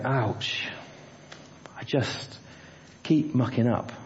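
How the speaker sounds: quiet, defeated sigh of a confession; despairing. Retold or imitated. Believer admitting failure to himself, quietly despairing. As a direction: let it drop, half-breathed, beaten.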